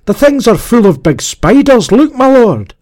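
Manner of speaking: high, loud, urgent yelling; alarmed cry. A narrator's reading. Gaunt man warning his lord of spiders, crying out. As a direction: yell a warning to your master. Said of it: "cried the voice of the gaunt man".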